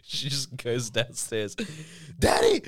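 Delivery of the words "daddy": high, loud yell for dad; tattling little sister. Retold or imitated. Little sister telling on [name] to dad, high tattling yell. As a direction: imitate a kid shouting to snitch.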